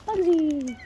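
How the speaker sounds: high sing-song call; calling the bird. Real, not acted. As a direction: lift the name up high to call.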